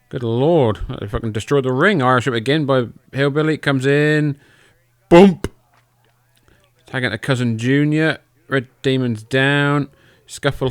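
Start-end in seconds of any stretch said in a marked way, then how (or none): 5.1-5.5 short, punchy yell, much higher; loud shout. Pretend. Calling the slam on the replay, a sudden loud shout. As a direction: shout the impact out. Mock commentating a big splash.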